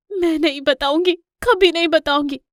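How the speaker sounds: high, loud, desperate refusal; defiant mother. Acted. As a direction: refuse the villain with all your force. Mother defies the villain lion. (माँ का ऊँचा, ज़ोरदार, अड़ा हुआ इनकार)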